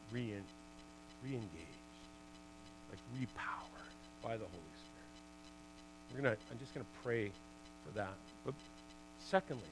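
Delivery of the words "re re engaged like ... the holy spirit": hushed, breathy plea; softly yearning pastor; whispered longing. Real, not acted. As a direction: drop to a prayerful whisper. Praying for renewal.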